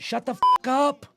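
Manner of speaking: loud, high-pitched outburst; exasperated shout. Real, not acted. Fan telling squabbling complainers off, exasperated shout. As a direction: shout them down, fed up. Snaps back at the online complainers.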